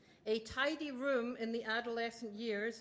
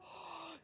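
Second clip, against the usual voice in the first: gasp; 5 semitones higher, 11 dB quieter than usual. Shown as a demonstration. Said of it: "I know I have to go".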